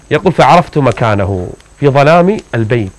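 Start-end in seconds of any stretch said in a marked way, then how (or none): none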